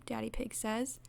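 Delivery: ordinary delivery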